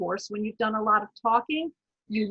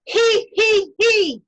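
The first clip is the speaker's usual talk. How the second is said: much higher, loud he, he from the throat; 10 semitones higher, 9 dB louder, much slower than usual. Shown as a demonstration. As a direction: giggle it out from the throat. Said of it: "breathe in".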